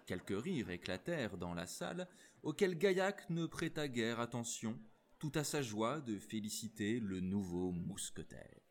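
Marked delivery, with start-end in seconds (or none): none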